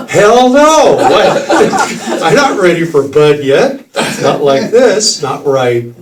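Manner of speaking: high, loud laughing panic; mock panic. Telling the room how he reacted to the kid, laughing panic. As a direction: freak out, half laughing.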